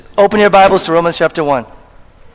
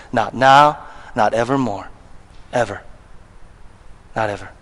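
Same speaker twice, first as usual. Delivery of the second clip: "Not now, not evermore, ever" dropped low and much softer; quiet emphasis; 8 semitones lower, 7 dB quieter than usual. Acted. Preacher quietly sealing the point to congregation, "Not ever" said soft and low. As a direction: let "Not ever" sink in softly. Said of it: "Paul says".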